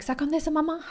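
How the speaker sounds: put-on high, childlike offering; daughter's voice. Daughter offering mum an ice block, high and sweet. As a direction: play the little girl helping. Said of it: "She's like".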